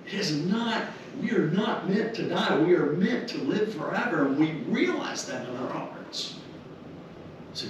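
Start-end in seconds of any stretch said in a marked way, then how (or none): none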